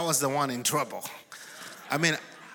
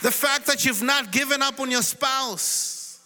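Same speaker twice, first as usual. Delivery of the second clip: high, loud, driving sermon delivery; fervent pulpit declaration, voice raised; full-force preaching; 8 semitones higher, 4 dB louder than usual. Real, not acted. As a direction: preach it to a climactic shout.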